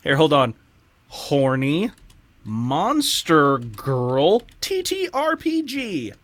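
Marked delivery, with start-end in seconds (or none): none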